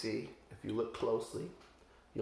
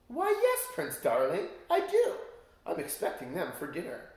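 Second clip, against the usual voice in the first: high sly witch voice, sweet and scheming; sugary witch invitation; 6 semitones higher, 3 dB louder than usual. Acted. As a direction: lure him in like a cackling crone.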